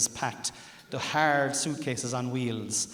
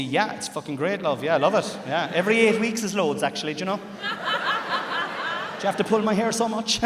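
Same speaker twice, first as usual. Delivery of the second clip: louder, higher mock eager answer; husband's exaggerated fake-happy reply; hammed-up enthusiasm; 7 semitones higher, 7 dB louder than usual. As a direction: oversell the cheerful lie. Ideal answer acted out.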